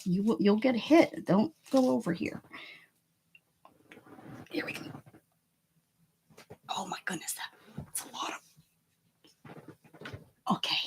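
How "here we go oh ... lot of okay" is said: awed whisper; hushed amazement. Real, not acted. Awed at the pile.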